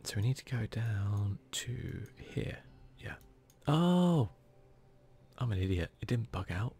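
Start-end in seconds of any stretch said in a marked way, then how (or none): 3.7-4.3 loud, high cry of realisation; sudden high "oh" that drops away; dismayed "oh". Real, not acted. Player realising his own mistake, dismayed "oh".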